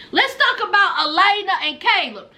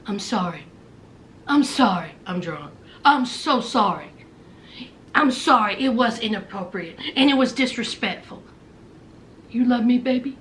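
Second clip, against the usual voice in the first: put-on much lower, softer sorry drunk voice; drunk Jasmine apologizing; 9 semitones lower, 3 dB quieter than usual. Jasmine apologizes to Gino, low soft drunk voice.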